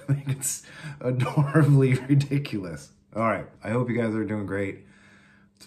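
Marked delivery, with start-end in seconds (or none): 0.0-2.9 real laughter breaking through, higher and louder; giggling at the punchline; laughing. Real, not acted. Laughing at own story.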